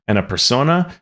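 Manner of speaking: normal talking voice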